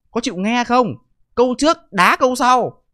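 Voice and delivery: slow, heated, hammering the point; exasperated. Real, not acted. Vlogger exasperated at critics.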